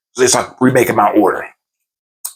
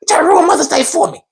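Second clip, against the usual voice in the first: high-pitched retold protest; hammed-up aggrieved refusal; heated. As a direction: snap back in squeaky mock indignation. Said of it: "Oh, I'm getting heated".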